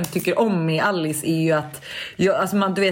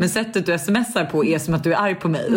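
ordinary talk, nothing marked

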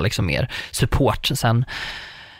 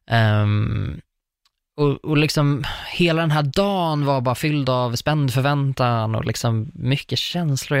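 usual speaking voice

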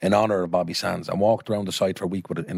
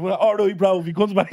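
put-on high-pitched, louder joke Pakistani accent; mock Pakistani accent; 12 semitones higher, 4 dB louder than usual. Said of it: "could have been from Pakistan".

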